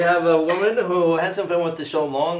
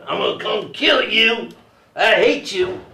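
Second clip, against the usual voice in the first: raised, higher mock-angry threat; 6 semitones higher, 5 dB louder than usual. Imagined sixteen-year-old calling from Arkansas, mock-angry threat. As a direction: play a teenager hurling a threat down the phone. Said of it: "calling me up from Arkansas saying".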